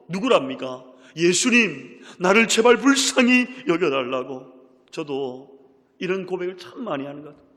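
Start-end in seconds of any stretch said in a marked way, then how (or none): 1.2-4.5 높고 아주 크게 애원하기; 제발 들어 달라고 힘껏 외치듯; 부르짖는 애원. Retold or imitated. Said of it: "소리질러 이르되". (소리 높여 매달리는 간청)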